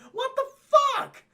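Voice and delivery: very high startled cry; shocked yelp. Retold or imitated. Spider-Man hitting an illusion wall, very high startled yelp. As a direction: yelp as you smack the wall.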